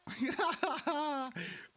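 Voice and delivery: breathy high giggle. Real, not acted. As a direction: giggle at his goofy teammate. Laughs at the other player.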